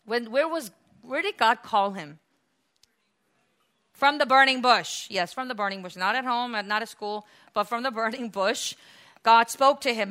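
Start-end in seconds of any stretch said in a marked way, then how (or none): none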